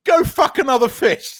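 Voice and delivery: high, loud comic put-down; mock Peacemaker insult. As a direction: fire the insult straight at Aquaman. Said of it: "turns around to Aquaman and goes".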